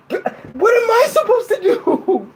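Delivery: high, slow laughing wail; mock despair. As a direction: wail it helplessly through laughter. Begging for food, helpless.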